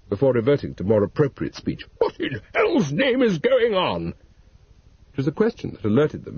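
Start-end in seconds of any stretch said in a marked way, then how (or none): 2.0-4.1 slow, higher outraged vicar's wife; aghast at the scene; shocked demand. A narrator's reading.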